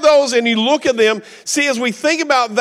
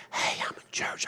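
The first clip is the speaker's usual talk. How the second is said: discreet whisper into a phone; hushed whisper; 15 dB quieter than usual. Acted. Preacher acting a polite churchgoer on the phone, whispered. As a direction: whisper it so nobody around hears. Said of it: "he wasn't quiet about it. He wasn't like".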